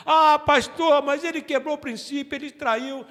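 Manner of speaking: high, loud protesting congregant; objecting listener. Retold or imitated. Pastor voicing a churchgoer's protest, mildly mocked. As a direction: voice the doubter's objection. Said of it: "congregant objection voiced".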